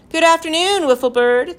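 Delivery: high, loud hailing call; shouting. A narrator's reading. Professor greets the bird atop the mast, shouting. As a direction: hail someone far up a mast. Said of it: "the professor shouted at her".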